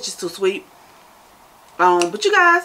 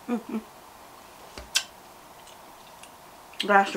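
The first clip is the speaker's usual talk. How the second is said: chewing while talking; speaking between bites; mouth full of food; 2 semitones lower, 5 dB quieter than usual. Real, not acted. Seen 0: Both eat and chew throughout; unclear which one speaks.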